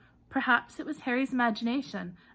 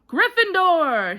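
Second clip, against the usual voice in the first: loud, high, slow drawn-out shout; slow triumphant cry; hat shouting; 9 semitones higher, 13 dB louder, slower than usual. A narrator's reading. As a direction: bellow the house to the hall. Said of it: "shouted the hat".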